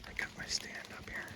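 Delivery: hushed whisper to camera; hunter's whisper. Real, not acted. Hunter on a ridge updating viewers from the stand, whispered.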